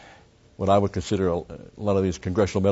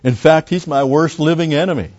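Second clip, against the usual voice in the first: higher and louder, emphatic; raised-voice storyteller's exclamation; exclaimed protest; 7 semitones higher, 6 dB louder than usual. A narrator's reading. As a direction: insist on it forcefully. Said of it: "Miller exclaimed".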